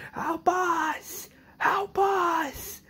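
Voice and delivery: very high, strained mock scream; mock cry for help. Rick hitting the gate for help, high strained cries. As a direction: imitate screaming for help without full volume.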